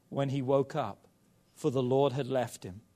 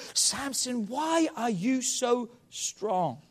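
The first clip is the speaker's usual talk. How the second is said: higher, slow drawn-out plea; pleading Delilah voice; 9 semitones higher, much slower than usual. Acted. Preacher voicing Delilah begging Samson, high and slow. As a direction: stretch the question out like nagging.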